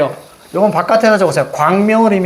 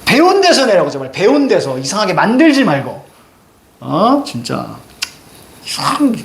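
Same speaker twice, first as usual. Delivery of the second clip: normal talking voice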